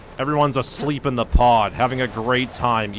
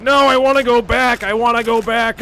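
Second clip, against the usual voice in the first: high, loud wailing protest; imitated bawling plea, pitched way up; mock crying; 10 semitones higher, 8 dB louder than usual. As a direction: wail it like a hammed-up crybaby.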